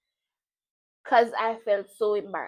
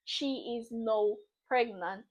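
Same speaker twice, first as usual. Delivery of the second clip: normal talking voice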